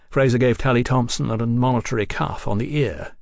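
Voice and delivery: ordinary delivery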